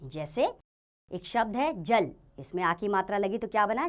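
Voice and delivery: ordinary delivery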